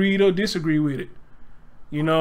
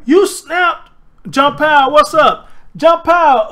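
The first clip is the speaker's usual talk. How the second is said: loud, high call to a friend in the chat; 8 semitones higher, 6 dB louder, slower than usual. Real, not acted. Greeting a viewer in chat with a loud, high call. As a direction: hail him across the room.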